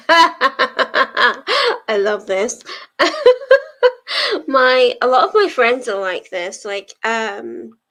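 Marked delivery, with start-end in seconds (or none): 0.0-2.6 loud laugh running into the words; real laughter. Real, not acted. Streamer reacting to the chat, laughing out loud. 2.7-4.4 high, loud peals of laughter; real giggling. Real, not acted. Streamer loving a chat message, high loud laughter.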